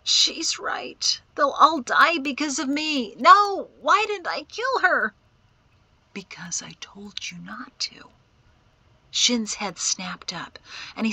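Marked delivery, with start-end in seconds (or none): 0.0-5.1 high-pitched anguished lament; distraught, voice pitched way up; tearful despair. A narrator's reading. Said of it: "tears tracking down his face". 6.1-8.1 soft, hushed reply; much softer, composed rebuke; quiet voice from darkness. A narrator's reading. Unseen voice answers from the darkness.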